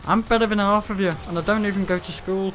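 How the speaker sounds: mock boast. Bragging in high voice.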